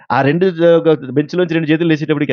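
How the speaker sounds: ordinary delivery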